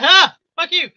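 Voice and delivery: high, mocking fly voice; cheeky fly. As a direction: taunt as a cocky little fly. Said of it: "this fly's like".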